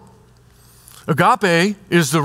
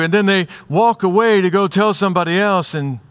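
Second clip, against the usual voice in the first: ordinary talk, nothing marked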